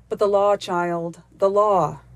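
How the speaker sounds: put-on a stern old woodsman rebuking the nymph; stern cry. A narrator's reading. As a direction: lay down the law, firm and heavy. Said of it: "cried Ak sternly".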